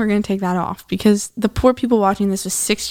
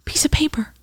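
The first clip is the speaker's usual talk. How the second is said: whispered aside; 6 semitones higher than usual. Real, not acted. Mocking flat princesses.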